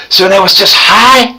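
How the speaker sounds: usual speaking voice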